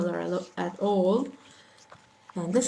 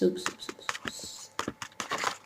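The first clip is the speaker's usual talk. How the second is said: whispered oops; 7 dB quieter, much slower than usual. Real, not acted. Mistake while writing.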